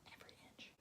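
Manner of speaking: breathy whisper repeating it; hushed echo. Acted. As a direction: whisper it, almost to yourself.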